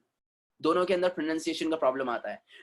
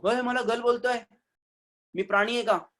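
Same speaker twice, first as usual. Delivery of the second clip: mock offended girl; 5 semitones higher, much faster than usual. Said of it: "चप्पल से मारेगी कि नहीं अपने को".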